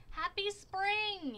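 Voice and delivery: slow, high, a touch of false cheer; drawn-out fake cheer; lying. A narrator's reading. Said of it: "he lied".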